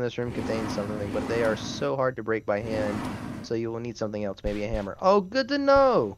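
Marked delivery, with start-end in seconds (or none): 5.0-6.2 high, slow, sarcastic cheer; mock excitement. Real, not acted. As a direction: fake delight, drawn out. Mock delight at game note.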